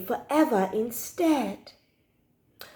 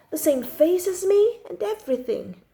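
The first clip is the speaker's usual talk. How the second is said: usual speaking voice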